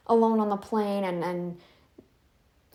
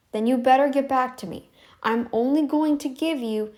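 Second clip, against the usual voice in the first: ordinary delivery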